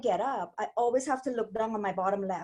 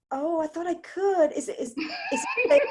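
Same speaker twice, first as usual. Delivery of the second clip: laughing innocent; 7 semitones higher than usual. Retold or imitated. Playing innocent to the gentleman.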